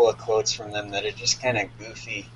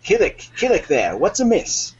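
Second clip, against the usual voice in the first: higher, louder summons; calling captain; 8 semitones higher, 5 dB louder than usual. Acted. Captain summoning his steward Killick in a part-reading, imperious call. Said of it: "I'll take the first line".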